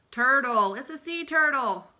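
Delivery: loud, bright answer; loud excited announcement; excited reveal. Acted. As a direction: announce it proudly.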